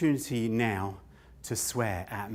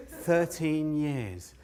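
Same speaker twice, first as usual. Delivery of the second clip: slow, stunned; 3 semitones higher, much slower than usual. Acted.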